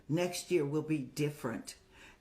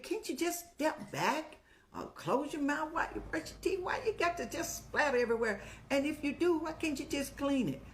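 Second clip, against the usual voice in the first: much higher, fast mock scolding; irritated fussing; 8 semitones higher than usual. To her husband about the splattered mirror, higher irritated fussing. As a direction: nag him like the old days, pitched way up.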